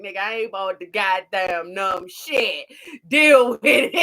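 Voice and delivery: a loud no-nonsense snap ending in a laugh; mock tough doctor. Doctor ignoring the patient's complaints, loud mock snap. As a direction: deliver it like the doctor who doesn't care. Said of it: "she just went straight in".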